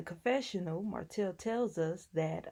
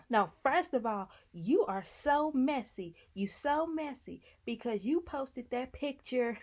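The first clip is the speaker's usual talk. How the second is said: mock scolding; 6 semitones higher than usual. Tisha scolding Marcel over the posted picture, mock scolding. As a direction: imitate a wife calling out her husband.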